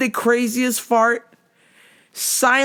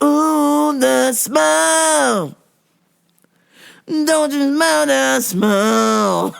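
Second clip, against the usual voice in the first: mock disgusted moaning; 6 semitones higher, much slower than usual. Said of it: "they're just like".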